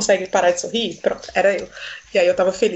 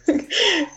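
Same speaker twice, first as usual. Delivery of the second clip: short laugh; 9 semitones higher than usual. Real, not acted. Laughing at the name mispronounced.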